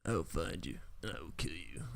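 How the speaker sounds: low, soft growling threat; a bad deep movie-hero voice; Liam Neeson impression. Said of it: "That was a bad Liam Neeson impression".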